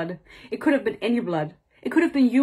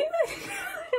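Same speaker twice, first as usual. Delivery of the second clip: a squeal of a laugh, much higher and soft; 8 dB quieter than usual. Real, not acted. She squeals at the absurd plot. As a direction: let out a high, strange laugh. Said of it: "freaking out in different languages".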